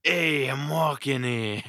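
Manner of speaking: loud-edged New York accent, high and drawn out. Shown as a demonstration. Showing a friend a New York accent, hammed-up and high. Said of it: "a New York accent".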